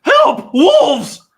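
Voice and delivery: cry for help. Acted. Joxer fleeing wolves, yelling for help in panic. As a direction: scream for help as the wolves close in. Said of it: "that cry for help".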